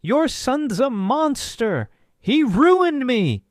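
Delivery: shrill high scream, drawn out slowly. Acted. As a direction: scream the accusation at full volume, dragging the words.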